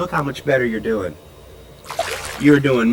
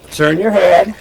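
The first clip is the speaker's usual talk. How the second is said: raised voice; 4 semitones higher, 6 dB louder than usual. Real, not acted. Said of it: "I have to raise my voice so she can hear me".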